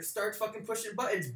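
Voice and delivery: ordinary talk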